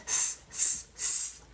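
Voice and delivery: long unvoiced hiss, s s s; hissing s sound. Shown as a demonstration. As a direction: hiss the s like a sizzling sausage. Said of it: "Do that with me".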